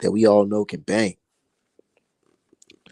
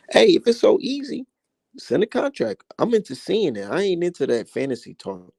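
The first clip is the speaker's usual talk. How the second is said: heated challenge; 8 semitones higher than usual. Real, not acted. Challenging the coach to sign.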